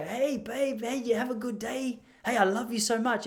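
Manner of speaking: high, singsong, affectionate hello; warm greeting. Retold or imitated. Husband greeting wife home from work, warmly and high-pitched. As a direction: lay the affection on thick in a lifted voice. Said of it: "Just approach quite warmly".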